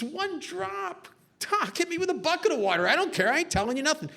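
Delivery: high-pitched, fast scoffing disbelief; hammed-up cocky defiance; macho American spy. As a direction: jeer at the doctor, high and quick. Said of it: "this macho American spy says".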